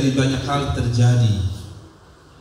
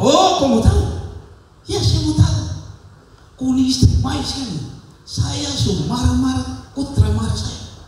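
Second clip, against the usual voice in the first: Papuan dialogue voice; 9 semitones higher than usual. Retold or imitated. Preacher acting Papuan husband and wife, high Papuan voices. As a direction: play the Papuan couple, pitched well up. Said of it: "gaya Papua".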